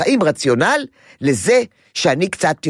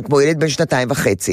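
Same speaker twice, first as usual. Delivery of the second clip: ordinary delivery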